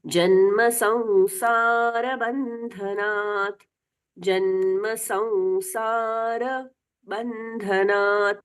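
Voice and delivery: slow chant, संसार with a full र. Shown as a demonstration. Teacher reciting for students, slow chant. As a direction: intone जन्म संसार बंधनात् slowly, र sounded fully. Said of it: "र का पूर्ण उच्चारण होना".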